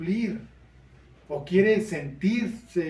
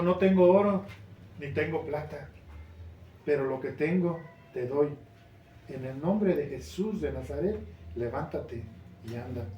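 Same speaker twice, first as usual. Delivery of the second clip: Peter's soft command; 4 semitones lower, 5 dB quieter than usual. A narrator's reading. Peter softly tells the lame man to rise, low and gentle. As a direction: lower and softer, a gentle proclamation.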